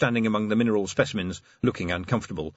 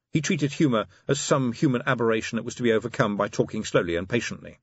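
ordinary delivery

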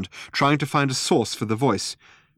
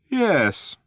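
lightly voiced maniac, raised pitch; a touch of madness, pitched up; passing maniac; 8 semitones higher than usual. A narrator's reading. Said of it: "said a passing maniac".

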